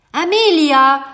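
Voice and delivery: loud, high shout of a name; stern call. A narrator's reading. Baker calling his daughter back, stern shout. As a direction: holler for her sternly across the street. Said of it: "rief da die strenge Stimme".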